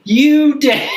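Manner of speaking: high mock-bright sarcasm; squeaky sarcastic retort. Sarcastic reply to police.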